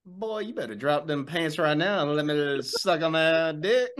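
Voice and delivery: slow, high sleazy drawl; sleazy officer voice. Acted. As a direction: leer it like a creepy officer. Lecherous officer impression.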